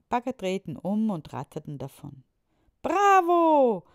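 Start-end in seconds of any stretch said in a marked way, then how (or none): 2.8-3.8 high, loud cheer. A narrator's reading. The children cheer as the diggers leave. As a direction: cheer with all your joy. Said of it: "riefen die Kinder".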